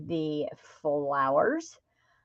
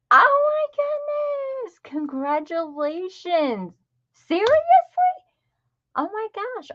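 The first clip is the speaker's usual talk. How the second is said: thrilled surprise; 13 semitones higher than usual. Real, not acted. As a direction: burst with happy astonishment. Learns a viewer is pregnant.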